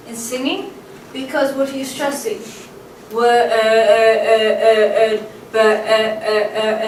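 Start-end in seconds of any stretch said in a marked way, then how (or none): 3.1-6.9 "Word" drawn out loudly into repeated "uh, uh, uh"; loud monotone vowel, "uh" after "uh", swallowing the word's ending; stressed vowel sound. Shown as a demonstration. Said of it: "you're stressing the vowel sound".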